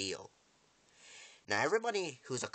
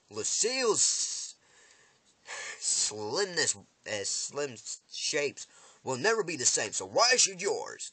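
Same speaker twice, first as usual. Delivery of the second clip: loud, high, slow building threat; menacing indignation; 6 semitones higher, 5 dB louder, slower than usual. Retold or imitated. Villain threatens woman's face over damaged bat, indignant menace. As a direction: build to a raised, drawn-out challenge.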